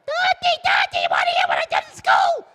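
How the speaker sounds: put-on very high, loud child's shriek. Child trying again to reach father, shrill shouting. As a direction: yell like a kid demanding attention. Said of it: "the kid calls out louder".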